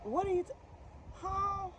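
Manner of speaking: high, questioning reenacted bafflement; baffled surprise. Fitter reacts to customer's excursion question, high and baffled. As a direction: pitch each question up, as if thrown. Her baffled reply to the proposition.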